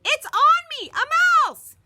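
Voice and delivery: very high, loud terrified yelling; panicked scream. A narrator's reading. Bully Marty screams in panic about the mouse. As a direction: scream for your life.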